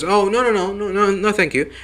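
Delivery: lightly voiced nervous [name]; nervous refusal. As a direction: play a panicked man politely declining. [name] nervously refusing visor help.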